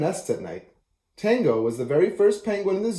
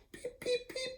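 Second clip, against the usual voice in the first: tiny squeaky chick peeps, very high and soft; baby chick peeping; 16 dB quieter than usual. Acted. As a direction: peep like a newborn chick inside its egg. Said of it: "it said".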